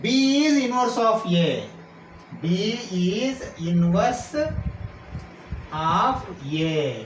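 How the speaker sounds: very slow, spaced-out words; slow dictation. Real, not acted. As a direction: spell it out word by word for note-takers. Teacher spells out definition slowly.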